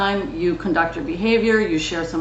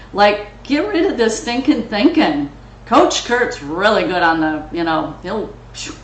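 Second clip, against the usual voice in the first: ordinary talk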